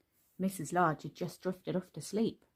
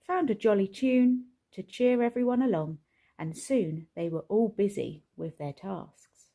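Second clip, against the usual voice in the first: ordinary delivery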